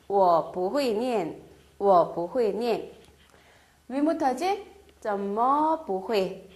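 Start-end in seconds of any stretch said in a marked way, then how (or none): 0.1-2.9 each syllable spelled out slowly; slow model reading. Shown as a demonstration. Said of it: "부정 형태는". 5.0-6.5 each syllable spelled out slowly; slow model reading. Shown as a demonstration. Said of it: "자, 어째서?".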